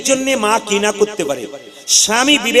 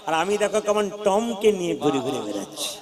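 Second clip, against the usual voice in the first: softer, mock-prissy city girl; boastful city girl; 3 semitones lower, 7 dB quieter than usual. City girl boasts to villagers about her dog, mock-prissy. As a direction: show off the pet in a small voice.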